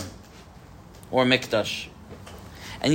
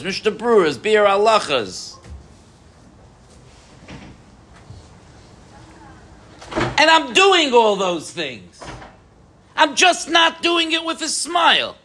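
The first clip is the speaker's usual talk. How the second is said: higher, incredulous complaining to God; cynical inner voice; 6 semitones higher than usual. Retold or imitated. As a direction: whine it like a put-upon servant. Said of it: "My cynical voice says".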